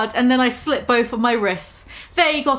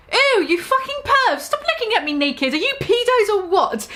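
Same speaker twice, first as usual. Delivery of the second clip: high disgusted yell; pitched-up outraged scream; 9 semitones higher than usual. Acted. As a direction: shriek at the peeping perverts. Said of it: "I screamed".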